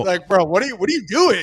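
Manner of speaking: incredulous mock voice. Mocking Pickens's antics.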